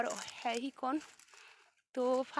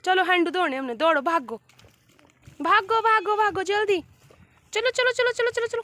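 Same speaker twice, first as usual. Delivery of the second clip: high, loud rising urging chant; excited hurrying; 6 semitones higher, 8 dB louder than usual. Real, not acted. Adult playfully hurrying children to go wash, high and loud.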